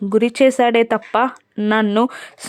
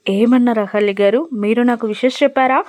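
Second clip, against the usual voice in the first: ordinary delivery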